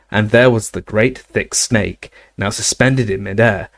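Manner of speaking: plain reading voice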